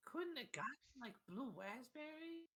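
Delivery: very high, put-on little kid voice; high-pitched child's grumble; whiny child. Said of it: "and goes".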